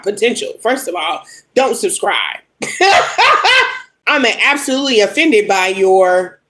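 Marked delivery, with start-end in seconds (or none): 2.6-3.8 sharp disbelieving laugh; scoffing laugh, much higher; incredulous laugh at a comment. Real, not acted.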